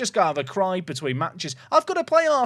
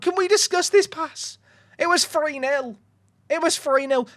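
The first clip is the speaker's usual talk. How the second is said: disbelief; 10 semitones higher than usual. Real, not acted. Disbelief at an assist.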